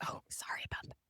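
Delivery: very soft, near-whispered reply; hushed retold apology. Singer to angry neighbour after his complaint, hushed. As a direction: mumble a barely voiced apology in retelling.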